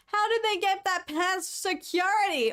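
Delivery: high, disbelieving question; incredulous. Real, not acted. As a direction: ask it in disbelief.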